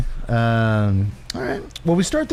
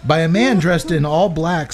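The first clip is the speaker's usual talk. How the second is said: usual speaking voice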